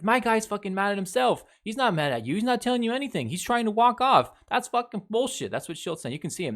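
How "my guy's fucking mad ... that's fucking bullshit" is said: high-pitched imitation of a manager arguing; mock indignant protest. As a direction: argue heatedly on your player's behalf, pitched high. Acting out manager defending his player.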